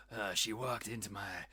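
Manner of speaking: low, quiet noir detective voice; a lightly voiced hard-boiled gumshoe; Clint Eastwood. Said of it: "that was more Clint Eastwood than hard-bitten gumshoe".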